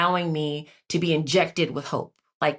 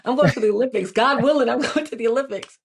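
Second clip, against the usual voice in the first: retelling a bold boast, pitched higher; bold, higher-voiced proclamation breaking into a laugh; bold declaration; 5 semitones higher, faster than usual. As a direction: announce it to everyone, high-pitched and a little amused. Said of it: "I was very bold".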